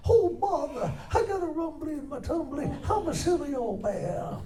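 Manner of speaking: high storybook-bear voice; Winnie the Pooh. Shown as a demonstration. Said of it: "I do a mean Winnie the Pooh".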